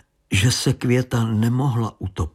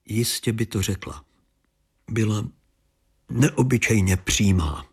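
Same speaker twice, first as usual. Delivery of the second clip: ordinary delivery, nothing marked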